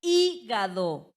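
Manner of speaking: "Hígado" lento, con HÍ fuerte y larga; énfasis en la sílaba tónica. Shown as a demonstration. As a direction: separa y marca fuerte el HÍ de "Hígado". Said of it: "¿dónde recae?". ("Hígado" silabeado, acentuando la antepenúltima: HÍ-ga-do)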